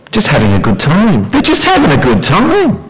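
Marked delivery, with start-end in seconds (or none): 1.3-2.8 much higher, breezy mock voice; voicing the crowd's carefree excuse; mimicking the worldly view. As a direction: toss the line off carelessly. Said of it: "That's all they're thinking".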